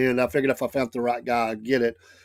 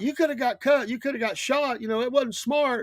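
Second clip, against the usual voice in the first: scolding wife; 10 semitones higher, 4 dB quieter than usual. Retold or imitated. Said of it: "Lynn's like".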